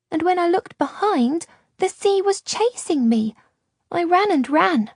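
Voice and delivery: put-on a scared small girl telling how the sea chased her; frightened little girl. A narrator's reading. Lost girl telling Luca about the wave, high and frightened.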